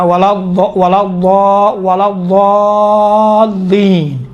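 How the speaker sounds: "الضالین" with a very long held, level heavy ض vowel; careful Quranic recitation. Shown as a demonstration. Teacher drilling ولا الضالین for students, drawn out slowly. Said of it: "دقت کریں".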